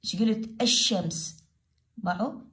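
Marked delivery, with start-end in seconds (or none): 0.6-1.4 الشمس with a long sh straight after the A; silent lam. Shown as a demonstration. Teacher shows the sheen is heard, lam silent. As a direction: say الشمس as "ash-shams", dropping the L. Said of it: "لا، اللام ما تلفظ".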